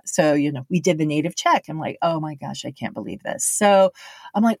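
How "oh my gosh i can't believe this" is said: muttered dismay. Retold or imitated. Dismay at bad script.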